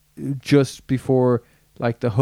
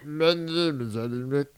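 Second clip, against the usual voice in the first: lightly imitating Alan Rickman; 4 dB quieter than usual. Showing his co-host the Rickman voice, lightly done. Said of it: "that kind of voice".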